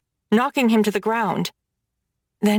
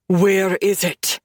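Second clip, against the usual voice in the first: vicious snarl; 2 semitones lower, slower than usual. Acted. Gavin snarls at the bleeding Brooks, slow and menacing. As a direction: snarl it slowly into his bleeding face. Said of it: "he snarls viciously".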